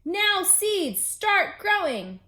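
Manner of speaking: high, loud, slow yell at the seeds; loud slow yell to make seeds grow; shout. Acted. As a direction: bellow it at the ground.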